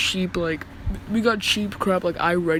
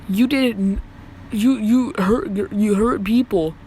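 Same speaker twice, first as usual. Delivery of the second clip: halting, repeated word starts; imitated stuttering; slower than usual. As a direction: stumble slowly over the accusation, restarting words. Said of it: "she's stuttering a lot".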